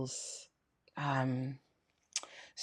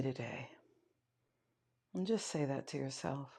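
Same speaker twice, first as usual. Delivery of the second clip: quiet meditation guide, long pauses between prompts; guided meditation; 3 dB quieter than usual. Acted. Yoga teacher guiding students to notice their breath, guided meditation. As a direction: lead them softly inward, leaving space.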